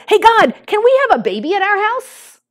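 put-on high, excited little-boy call to God; child shouting. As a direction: call up to God like a thrilled toddler. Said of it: "he shouted".

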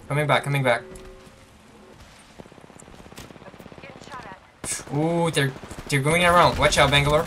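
loud, high, urgent battle callouts; shouting orders over battle. Real, not acted. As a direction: yell warnings as enemies flank. Enemies flanking mid fight.

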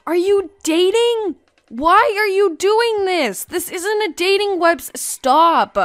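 high-pitched, indignant telling-off; mock scolding. Player telling others this isn't for dating, mock scolding. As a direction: scold them in playful outrage.

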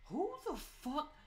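very high, slow stunned "Who the fuck?"; shocked outburst. Real, not acted. Gamer spotting a weird picture, shocked high outburst. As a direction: let the shock stretch the words out.

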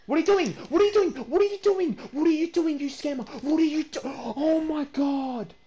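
high, loud repeated shrieks; outraged screaming. Real, not acted. Gamer catching the scammer in the act, screaming.

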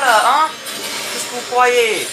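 loud, high-pitched challenge; indignant outburst. Real, not acted. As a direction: throw it at the enemies, affronted. Indignant taunt at game enemies.

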